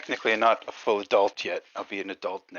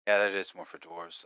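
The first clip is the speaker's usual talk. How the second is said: normal talking voice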